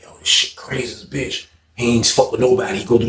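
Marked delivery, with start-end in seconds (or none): 0.0-1.4 low, whispered disbelief; retold hushed aside. Storyteller marvelling to himself amid the trouble, hushed whisper. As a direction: whisper it to yourself, barely voiced.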